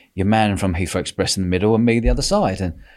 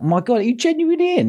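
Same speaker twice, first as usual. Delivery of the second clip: disbelief; 14 semitones higher than usual. Retold or imitated. Bent over laughing.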